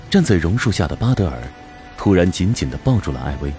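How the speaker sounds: ordinary talk, nothing marked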